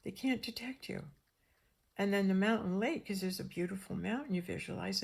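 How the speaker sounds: normal talking voice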